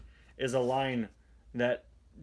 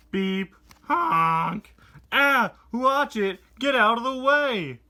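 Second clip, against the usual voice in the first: high, loud angry yell at a car; mock road rage; 9 semitones higher, 7 dB louder, slower than usual. Reviewer voicing a driver yelling at McQueen, mock angry. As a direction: yell at him to move, hammed up.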